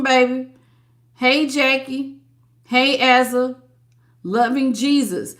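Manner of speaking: ordinary delivery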